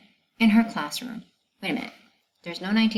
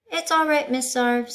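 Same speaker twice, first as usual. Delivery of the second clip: high, louder eager student; 7 semitones higher, 6 dB louder than usual. Acted. Best student Virginia comforting her teacher, eager. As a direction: reassure the teacher like a helpful pupil.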